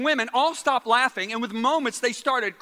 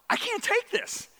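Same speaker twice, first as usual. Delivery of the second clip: high, thin, soft protest; mock high-pitched inner panic; retold overwhelmed thought; 12 semitones higher, 4 dB quieter, slower than usual. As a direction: squeal it softly, as if overwhelmed. Retold panicked thought of his head.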